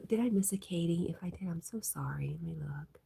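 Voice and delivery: ordinary talk, nothing marked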